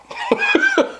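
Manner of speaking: squeaky burst of laughter; high laugh. Real, not acted. Laughing at his own noise.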